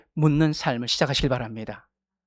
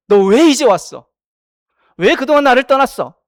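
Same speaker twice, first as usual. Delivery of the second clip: scolding God; 5 semitones higher, 5 dB louder than usual. Pretend. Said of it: "라고 책망하지 않았습니다".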